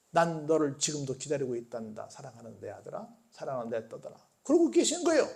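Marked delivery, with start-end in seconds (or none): 0.1-4.1 low, soft fatherly voice; tender, affectionate. Acted. God tells beloved children he still waits, tender and low. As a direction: hush it down to a gentle, loving call. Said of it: "그 다정한 얼굴로 기다리시고".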